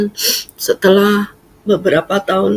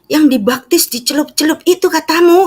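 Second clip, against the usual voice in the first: high eager mother voice; keen old mother, pitched up; mother asking for baptism, high and eager; mom eager; 11 semitones higher than usual. Retold or imitated.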